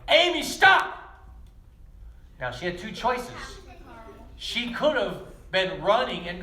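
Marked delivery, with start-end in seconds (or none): 0.1-1.0 sudden shouted warning, much higher and louder; yell. Retold or imitated. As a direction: shout her name to stop her dead. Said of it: "and he yelled".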